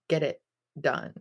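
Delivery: ordinary talk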